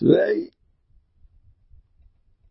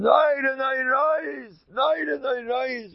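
tearful pleading; 8 semitones higher than usual. Retold or imitated. Emotional pleading no in the story.

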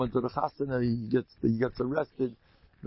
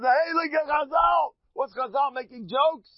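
hammed-up outrage at the jokes; mock outraged shout; 13 semitones higher than usual. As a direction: shout it like a scandalized critic. Mocking critics of his jokes.

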